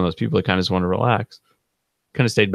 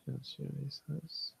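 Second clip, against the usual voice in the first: very soft, slow and high; half-voiced while checking a phone message; absent, drawn-out murmur; talking to himself; 7 semitones higher, 20 dB quieter, slower than usual. Real, not acted. Reading a text to himself.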